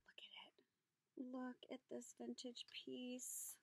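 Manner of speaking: slow, hushed whisper; awed whisper. Real, not acted. Admiring a vintage piece from Gail, slow awed whisper. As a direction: breathe the words in wonder.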